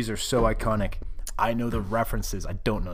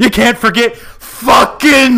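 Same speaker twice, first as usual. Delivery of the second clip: drawn-out laughing yell; slow wrestling promo shout; slow hype shout; 11 semitones higher, 13 dB louder, much slower than usual. Pretend. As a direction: stretch it out and yell. Hype bit, shout heard.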